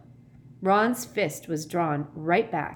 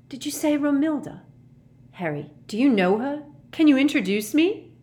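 high, excited questions growing louder; hopeful, raised excited voice; eager lovestruck pleading; 9 semitones higher, 6 dB louder than usual. A narrator's reading. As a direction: beg for an introduction, smitten. Lovestruck Ron begs an introduction.

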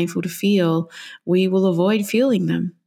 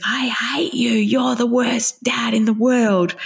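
put-on higher child voice, shouted, slow; a small kid yelling at dad in a tantrum; angry child shout; 5 semitones higher, slower than usual. Four-year-old yelling at dad.

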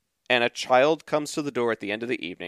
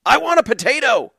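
put-on higher, louder demanding girl; 10 semitones higher, 7 dB louder than usual. Teenage girl at the door demanding a potato loudly. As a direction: insist like a teenager who wants it. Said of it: "teenage girl says".

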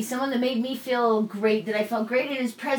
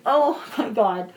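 tearful; 3 semitones higher, 4 dB louder, slower than usual. Retold or imitated. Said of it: "I just fucking cried".

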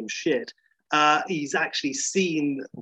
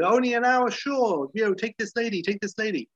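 higher, slick pushy hustler voice; mock pimp; 9 semitones higher than usual. Motel pimp pushing a lady on Larry, in a slick put-on voice. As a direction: sell it like a sleazy pimp. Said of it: "pimp offering prostitute".

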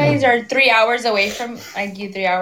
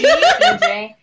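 very high, laughing squeal of the letters; laughing burst; 5 dB louder than usual. Real, not acted. Guessing names, laughing.

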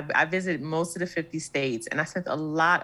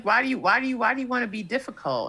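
mock exasperation; 6 semitones higher, faster than usual. Acting out complaint to difficult eater.

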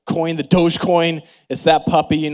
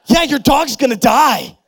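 higher, louder mock-blunt dad; 10 semitones higher, 6 dB louder than usual. Dad bluntly telling his crying kids the dog will die, mock harsh. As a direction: toss it off with careless bluntness. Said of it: "You don't say that".

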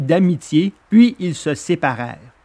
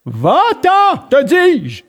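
high, louder threatening bark of a fisherman; menacing fisherman; 11 semitones higher, 4 dB louder, much slower than usual. A narrator's reading. Fisherman driving off the hungry dog, menacing shout. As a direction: chase the dog off with a menacing yell. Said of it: "lui répéta le pêcheur".

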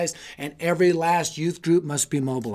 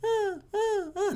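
high-pitched, soft whimper like a dog; thin, high mimicked whines; imitated dog whine; 6 dB quieter, slower than usual. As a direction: whine like a crated puppy. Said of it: "I hear him whine again".

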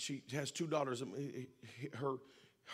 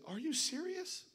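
mild retold disbelief; slower than usual. Grieving mother questions the pastor's advice, imitated disbelief.

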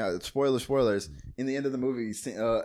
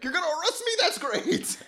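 high, delighted mock reply; hammed-up joy at bad news; pitched-up elated movie hero imitation; giddy George. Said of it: "He's like".

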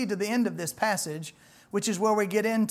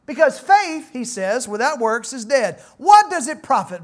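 higher, louder, hard-hitting challenge; blunt James; 6 semitones higher, 7 dB louder than usual. Retold or imitated. Apostle James challenging believers to show their faith, blunt and loud. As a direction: confront them like James, no softness.

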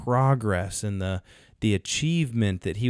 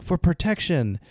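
high-pitched retold answer; animated movie quote; acted guards' reply; 8 semitones higher than usual. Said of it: "they're like".

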